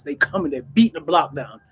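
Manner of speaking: normal talking voice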